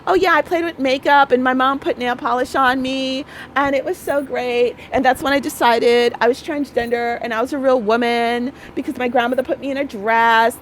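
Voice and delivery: high mocking girly voice; mocking quote. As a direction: mimic them in a high silly tone. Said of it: "all they talk about is like".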